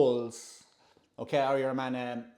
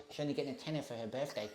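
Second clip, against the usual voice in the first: imitating a touchy mother; 9 dB quieter, faster than usual. Woman on her daughter's birthday money, quiet mimicked grumble. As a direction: imitate her, softly and curtly. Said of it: "the way she looked at me and said it".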